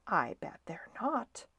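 soft, whispery cat retort; whispered taunt. Acted. Snowbell the cat contradicting Stuart's boast, whispered taunt. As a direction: doubt him in a whisper.

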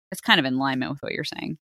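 ordinary talk, nothing marked

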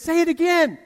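loud, high imitation of a goading crowd; high, shouted mock demand; egging on. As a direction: call it out, mimicking eager colleagues. Retold laughing colleagues egging him on.

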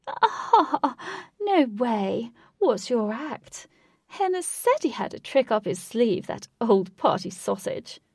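loud, high posh delight; posh accent. A narrator's reading. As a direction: react with hearty posh bluster. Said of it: "He said in a posh accent".

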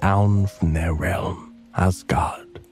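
plain reading voice

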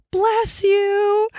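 high, bright-pitched call of bless you from across the courtyard; neighbor calling out. Retold or imitated. Neighbor calling across the courtyard to a sneezer, high mock call. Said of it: "I heard this".

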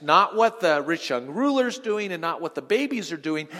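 ordinary talk

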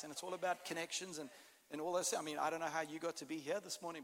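normal talking voice

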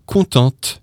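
"contente" with the final t sounded; feminine ending. Shown as a demonstration. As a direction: let the t ring at the end of "contente". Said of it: "if you're a girl".

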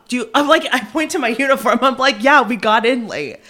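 loud, high, laughing retelling; high, loud excitement with a laugh in it; excited retelling. Retold or imitated. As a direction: tell it bursting with excitement. Frantic retelling, pointing at uniform.

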